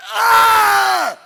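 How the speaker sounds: loud high pained scream; battle exertion yell. Shown as a demonstration. Voice actor demonstrating game death screams. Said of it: "standing way back here from the mic going".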